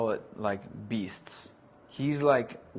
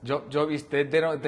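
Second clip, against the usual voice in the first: put-on laughing Russian accent, a little higher; 4 semitones higher, 6 dB louder than usual. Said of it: "in my fucking Russian accent".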